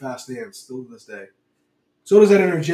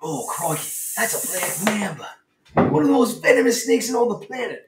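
mock Australian wildlife host; 5 semitones higher than usual. Host presenting a sock puppet snake, mock Australian awe.

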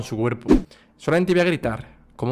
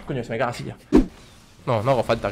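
usual speaking voice

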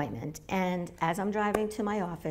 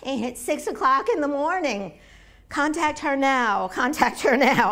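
urgent; 7 semitones higher, 8 dB louder than usual. Retold or imitated. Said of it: "I said".

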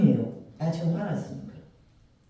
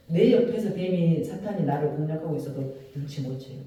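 ordinary delivery

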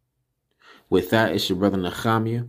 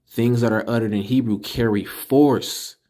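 ordinary talk, nothing marked

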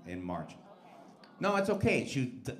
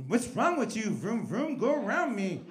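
annoyed driver; 7 semitones higher, 3 dB louder, slower than usual. Retold or imitated. Yelling at tailgater in car.

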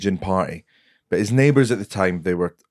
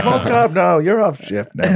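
high, loud coaxing impression; Tom Stade; 11 semitones higher, 4 dB louder than usual. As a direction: urge the policeman in a high, loud put-on voice. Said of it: "you doing an impression of him".

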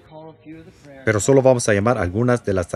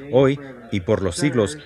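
ordinary delivery

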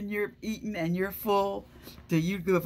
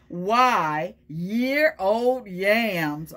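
put-on reading to children; 3 semitones higher, 5 dB louder, much slower than usual. Reads the truck's menu.

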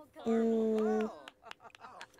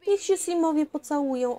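excited; 6 semitones higher, 6 dB louder than usual. Real, not acted. Excited about the Sims kissing.

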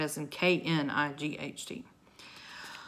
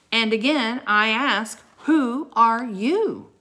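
loud, higher, stretched on the question; insistent demand; 6 semitones higher, 9 dB louder, slower than usual. A narrator's reading. King presses the silent knight again, insistent. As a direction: demand an answer, slowing to hammer each word.

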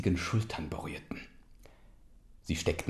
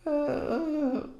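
high cub hesitation; 3 dB louder than usual. Acted. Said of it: "machte er".

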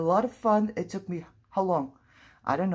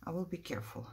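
much softer, murmured reassurance; quiet to self; 11 dB quieter, faster than usual. Real, not acted. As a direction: say it under your breath, steadying yourself. Repeats it softly to self.

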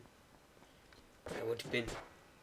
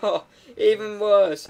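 loud, higher exasperated groaning; mock dismay; 5 semitones higher, 8 dB louder than usual. Player hearing the test is riskier, mock exasperated. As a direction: push it up high, exasperated.

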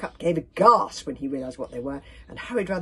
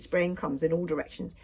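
usual speaking voice

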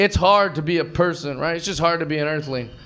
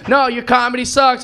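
higher, louder mock heckle; acted heckler raising their voice; insistent heckler; 6 semitones higher, 6 dB louder than usual. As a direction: throw the insult back harder.

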